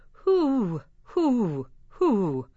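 high hoots sliding down; playful owl call for kids. Pretend. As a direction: hoot like a night owl. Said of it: "Hoot like an owl".

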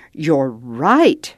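usual speaking voice

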